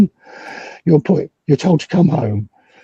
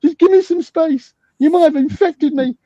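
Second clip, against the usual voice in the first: much higher, trembling-sounding pleading voice put on to disarm him; mock frightened plea; 14 semitones higher than usual. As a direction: play the scared vulnerable man, higher and slower. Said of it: "I said".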